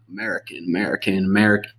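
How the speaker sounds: warming up an American accent; trying American accent. Shown as a demonstration. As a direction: feel your way from German into an American accent. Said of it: "like, an American accent".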